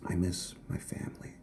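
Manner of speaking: unvoiced whisper; whispered confession out of nowhere; whispered aside. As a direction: let it slip out as a sad whisper. Sudden sad aside.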